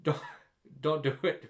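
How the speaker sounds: laughing through the warning; real laughter breaking in. Real, not acted. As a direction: chuckle while saying don't do it. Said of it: "You could tell I'm laughing".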